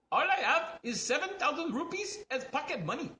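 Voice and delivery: high put-on voice with a laugh; poor customer character. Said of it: "then the poor guy, he comes back and goes".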